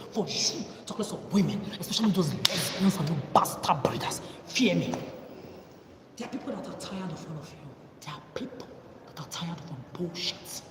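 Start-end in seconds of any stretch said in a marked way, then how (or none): 6.2-10.6 soft, breathy threat; menacing whisper. Real, not acted. Warning the breeders that people are tired, menacing whisper.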